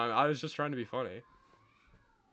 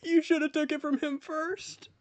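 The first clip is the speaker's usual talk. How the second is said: high dramatic voice; 11 semitones higher than usual. Acted.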